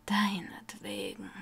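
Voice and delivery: rasping, breathy whisper; slow croaking whisper; croaked. A narrator's reading. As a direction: croak it out through a sick chest. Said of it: "krächzte er".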